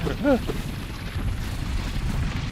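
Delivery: airy, silent-wheeze laugh; breathless laugh. Real, not acted. Streamer cracking up at the mirror joke, in a breathless wheeze. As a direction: wheeze with laughter, barely voiced.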